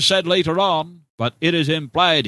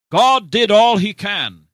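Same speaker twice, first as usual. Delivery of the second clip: loud emphatic proclamation; 6 semitones higher, 6 dB louder than usual. Real, not acted. Preaching emphatic climax.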